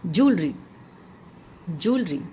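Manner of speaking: ज्वेलरी said "JOOL-ree"; two beats for ज्वेलरी, joo then ree; "joo" sound. Shown as a demonstration. Teacher drilling the word for students, "JOOL-ree". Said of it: "जू की आवाज़ आती है".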